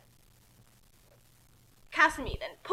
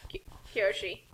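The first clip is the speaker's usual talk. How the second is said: whisper; 5 semitones lower, 5 dB quieter than usual. Real, not acted.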